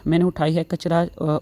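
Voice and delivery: ordinary talk, nothing marked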